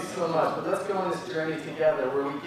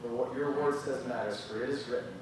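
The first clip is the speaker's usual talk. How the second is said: prayer; 4 semitones lower than usual. Acted. Leads a closing prayer.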